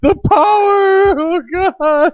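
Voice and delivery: high wailing lament; mock panic cry. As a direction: wail in hammed-up horror. Mock panic about lost power.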